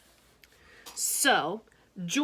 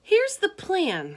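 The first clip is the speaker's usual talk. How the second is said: put-on high, louder girl's voice; Harriet's voice; 13 semitones higher, 6 dB louder, slower than usual. Harriet teasing George at lunch, high girlish voice. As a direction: pipe up as a chatty girl.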